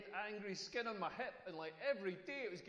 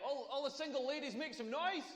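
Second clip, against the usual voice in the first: mock hype-man; 6 semitones higher than usual. Hyping the crowd like a DJ.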